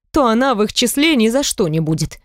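ordinary delivery, nothing marked

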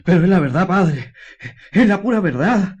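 loud, begging, rising insistence; desperate pleading. Acted. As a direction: swear it's true, beg him to believe. Man begs priest to believe.

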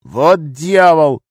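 drawn-out, high, loud oath; angry curse. A narrator's reading. Gunman hearing pier is mined, loud curse. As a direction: curse loudly, stretching it out.

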